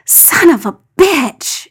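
slow, strained gasp of pain; gasped curse. A narrator's reading. John gasping as his knees buckle under everyone. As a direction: force it out through the pain. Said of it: "he gasped".

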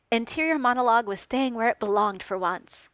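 ordinary delivery

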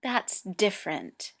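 usual speaking voice

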